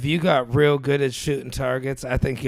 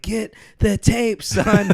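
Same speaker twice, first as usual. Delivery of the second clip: high, drawn-out order, imitating a panicked father; retold yelling dad; 8 semitones higher, 3 dB louder, slower than usual. Injured father ordering his scared son to fetch tape, mock yell.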